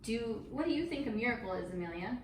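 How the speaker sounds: usual speaking voice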